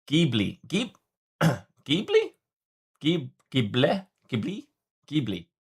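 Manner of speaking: Ghibli tried several ways, rising and falling; unsure attempts at Ghibli; trying out Ghibli. Shown as a demonstration. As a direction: sound out Ghibli as GIB-lee. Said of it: "try pronounce as".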